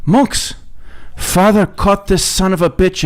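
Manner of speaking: high-pitched announcing nuns; nuns calling monks. Acted. Nuns handing the fish to the monks, high-pitched call. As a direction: hail them high and pass on the order.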